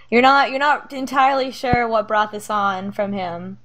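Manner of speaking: ordinary talk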